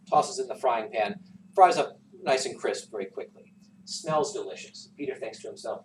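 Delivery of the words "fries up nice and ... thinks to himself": ordinary delivery, nothing marked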